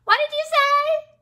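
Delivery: very high, loud cricket voice; high-pitched, shouted question; oblivious cricket. A narrator's reading. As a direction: squeal it loudly like a little cricket. Voicing the cricket.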